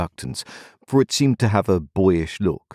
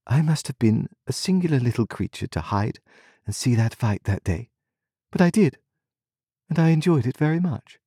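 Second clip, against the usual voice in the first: put-on lightly higher, cool young woman; haughty young lady; 6 semitones higher than usual. A narrator's reading. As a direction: read her as a proud young lady. Estella voiced in reading.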